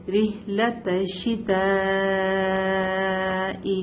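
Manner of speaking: الشتاء held four to five counts, very slow; long madd. Shown as a demonstration. Teacher shows student the madd, long held. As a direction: stretch the final آ of الشتاء. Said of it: "یہاں پہ مد متصل ہے".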